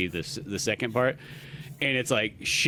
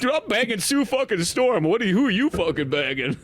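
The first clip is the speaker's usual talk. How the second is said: bragging Namor; 9 semitones higher than usual. Retold or imitated.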